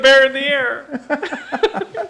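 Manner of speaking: slow laughing trucker CB voice; over walkie talkie. Retold or imitated. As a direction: drawl it like a trucker on the radio.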